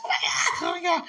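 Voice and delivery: high, loud laughing oh my God; laughing shriek. Retold or imitated. Telling a friend about the mouse, high and laughing. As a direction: squeal it through laughter. Retelling the shock.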